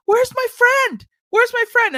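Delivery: put-on high little-girl calling. Little girl at church looking for her friend. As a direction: search eagerly like a small child. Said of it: "they're like".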